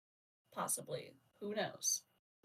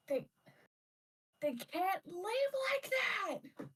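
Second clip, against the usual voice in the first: a long, high, drawn-out cry, much higher and louder; anguished shout; 11 semitones higher, 4 dB louder, slower than usual. Acted. Tagok screaming into the empty air over her lost kin, full shout. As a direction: wail it to the sky, slowly. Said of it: "starts shouting".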